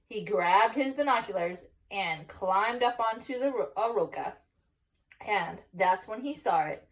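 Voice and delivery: ordinary delivery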